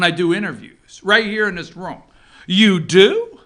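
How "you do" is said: loud incredulous "You do?"; mock Todd disbelief. Host mimicking Chuck Todd's incredulous reaction to the senator. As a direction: sound stunned and amused. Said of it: "Chuck Todd reactions".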